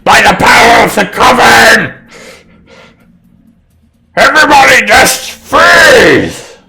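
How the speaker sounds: very high, very loud screamed incantation; yelled spell. Acted. Hag casts a spell on the whole party, shrieked command. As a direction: scream the command with full force. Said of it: "holds up her hands and just yells".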